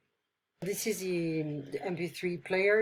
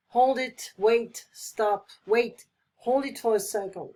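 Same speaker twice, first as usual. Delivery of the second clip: loud urgent call to stop; loud hold it, wait, stop; 2 semitones higher, 4 dB louder than usual. Shown as a demonstration. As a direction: shout them to a halt. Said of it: "It's an urgent situation to ask someone to stop".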